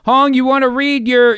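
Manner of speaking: usual speaking voice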